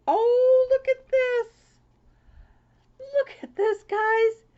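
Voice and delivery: high, loud squeal of delight; high, loud, thrilled cry; excited squeal. Real, not acted. Opening a cute card.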